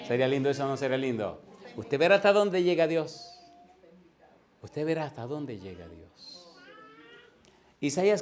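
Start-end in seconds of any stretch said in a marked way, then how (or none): 1.8-3.4 loud, higher-pitched preaching promise; emphatic proclamation. Real, not acted. Preacher's climactic line, then repeats softly. 4.6-6.4 softer, subdued preaching promise; hushed repetition. Real, not acted. Same line repeated hushed for effect.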